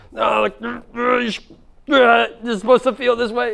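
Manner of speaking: high, hammed-up flustered fussing; mock overreaction. Coach mimicking someone reacting to emotions, mock-flustered. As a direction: splutter like someone fighting their own feelings. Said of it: "reacting to your emotions in that way".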